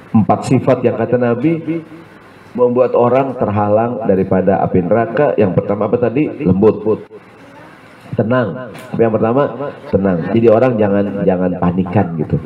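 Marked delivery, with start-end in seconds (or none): none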